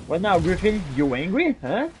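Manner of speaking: ordinary talk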